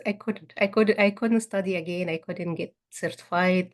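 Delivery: ordinary delivery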